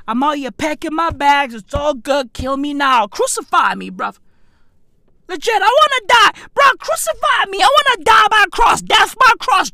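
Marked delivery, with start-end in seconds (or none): 5.3-9.7 high, loud, rushing outburst; hammed-up martyr's wail; melodramatic despair. Vlogger begging to be crucified over the deal, high shouted despair.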